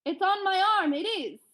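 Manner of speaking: high, loud boast that settles back down; cocky shouted boast, easing at the end; bragging. A narrator's reading. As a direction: brag back at the giant. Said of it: "I bragged".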